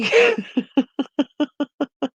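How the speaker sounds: high, rapid chuckling laugh; amused laugh at a lost thought; real giggling cackle; laughing at oneself. Real, not acted. Laughs at losing her thought.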